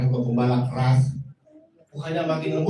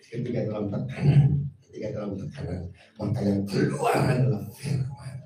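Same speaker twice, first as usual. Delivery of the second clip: lower, soft, earnest exhortation; soft pastoral appeal; 6 semitones lower, 7 dB quieter than usual. Real, not acted. Pastor gently urging the congregation toward soft hearts. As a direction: ease down and plead gently.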